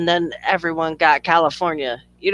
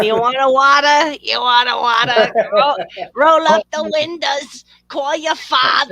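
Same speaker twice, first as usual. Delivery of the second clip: "wattah", "fathah", dropped r's, higher and louder; Boston accent; 6 semitones higher, 4 dB louder than usual. Retold or imitated. Mocking Boston accent to friends, hammed-up Boston accent. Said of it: "In Boston".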